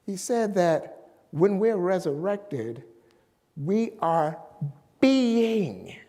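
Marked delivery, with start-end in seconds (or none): 3.5-6.0 BEING set apart and drawn out; emphasis on BEING. Real, not acted. Preacher quoting a reverend's teaching to the congregation, stressing BEING. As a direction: lean hard on the word being, after a short pause. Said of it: "putting extra emphasis on the word being".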